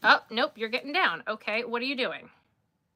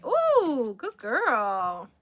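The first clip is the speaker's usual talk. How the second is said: praising dog; 3 semitones higher, much slower than usual. Real, not acted.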